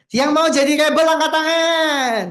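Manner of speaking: high, loud and slow, rallying the crowd; slow, drawn-out call to an audience; calling out to the room. Real, not acted. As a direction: call the rebels to raise their hands.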